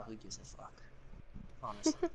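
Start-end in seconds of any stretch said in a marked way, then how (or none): 0.0-0.8 low dismissive mutter under the breath; muttered aside. Real, not acted. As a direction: throw it away under your breath. Muttered aside, then repeated louder.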